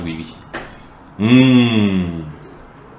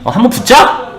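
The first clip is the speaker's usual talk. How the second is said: acted challenge; 10 semitones higher, 4 dB louder than usual. David challenges the giant.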